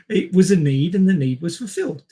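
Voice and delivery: ordinary talk, nothing marked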